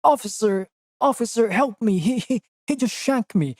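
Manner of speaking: high, pleading mock British victim; hammed-up victim. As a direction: whine for the officer like a cartoon. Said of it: "then it's like".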